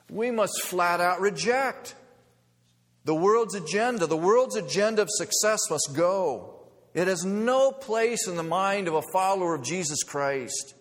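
impassioned pulpit climax; full-force preaching. Acted. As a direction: preach it with conviction.

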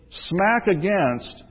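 slow, deliberate smack against; emphatic slowdown. Real, not acted. Preacher stressing the church's clash with today, slowed right down. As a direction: drag the words for weight. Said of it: "emphasis in sermon".

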